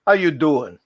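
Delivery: "how you doing" restyled as a New York greeting; New York accent. Shown as a demonstration. Correcting own greeting to a drone-flying pal, New York accent. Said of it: "Or should I say".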